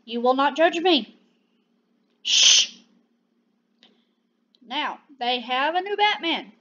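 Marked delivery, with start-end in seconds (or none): 2.2-2.8 sharp, loud hushing sound; loud shush. Real, not acted. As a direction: shush them firmly. Jokingly hushes anyone judging.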